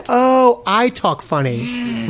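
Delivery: high, slow dawning realisation; retold realisation. New Yorker retelling finding they're the different one, dawning realisation. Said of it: "And I say".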